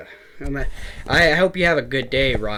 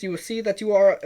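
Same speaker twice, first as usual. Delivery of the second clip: imitating an Indian call-center accent; Indian scam caller accent; 8 semitones higher than usual. Retelling a scam call, imitating the Indian caller's accent. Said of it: "like, an Indian guy".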